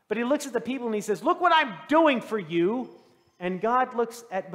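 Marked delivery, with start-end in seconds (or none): 1.2-2.9 loud, high, drawn-out proclamation; acted self-important announcement; showy boast. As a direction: show off to the crowd, voice raised. Moses boasts as he strikes rock.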